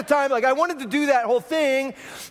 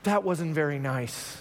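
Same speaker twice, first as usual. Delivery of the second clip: put-on child's quiet rebuke; 8 semitones lower, 6 dB quieter, slower than usual. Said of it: "And he goes".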